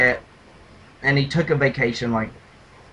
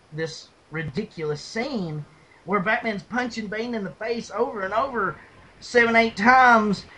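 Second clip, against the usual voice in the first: ordinary talk, nothing marked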